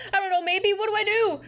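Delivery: panicky high voice. Acted. Panicked character line read aloud.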